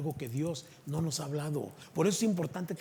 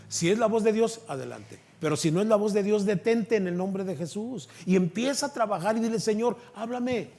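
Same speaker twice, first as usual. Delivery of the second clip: louder, raised preaching, slowing at the end; fervent preaching; 6 semitones higher, 6 dB louder than usual. Acted. Preacher urging the congregation to seek God, fervent. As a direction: drive the call home to the church.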